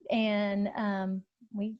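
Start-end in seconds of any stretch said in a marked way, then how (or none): none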